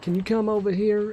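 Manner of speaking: lightly voiced older southern lady; a hint of a southern lady's lilt. Older lady calling a teen over, lightly imitated southern voice. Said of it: "one of the older ladies, she's like".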